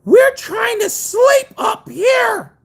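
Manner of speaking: very high, very slow, loud mock wail; an old woman shouting down, crying out; angry old lady. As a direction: scold the noisy walker from above. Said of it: "and it was this lady".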